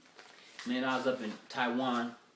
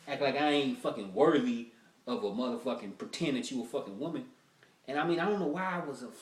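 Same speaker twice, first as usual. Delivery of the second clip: ordinary talk, nothing marked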